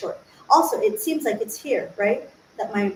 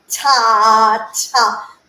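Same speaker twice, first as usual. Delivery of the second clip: loud strained "ta" with clenched jaw; tense clenched ta; 8 dB louder than usual. Shown as a demonstration. Showing the wrong tense way, strained "ta". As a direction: push "ta" out with a tight jaw. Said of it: "If we're like".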